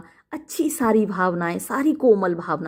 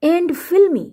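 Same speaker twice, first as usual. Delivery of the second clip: higher and louder "And fill me"; dramatic plea; 9 semitones higher, 4 dB louder, much slower than usual. Shown as a demonstration. Teacher voicing Lady Macbeth's cry to spirits, raised. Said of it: "teacher voices Lady Macbeth".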